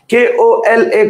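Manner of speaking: each letter spaced out slowly; slow letter spelling. Shown as a demonstration. Teacher to class, spelling the word very slowly. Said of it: "teacher spells word letter by letter".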